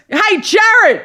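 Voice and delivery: high mock call across the store; enthusiastic boom. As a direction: imitate an overkeen salesman hollering. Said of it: "In the most enthusiastic, loud boom".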